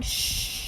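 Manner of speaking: soft breath-only "shh"; hushing. A narrator's reading. Dad shushing mom during the play, hushing. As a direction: gently shush someone.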